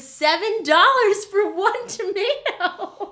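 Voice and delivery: laughing. Real, not acted. Laughing while recounting tomato price.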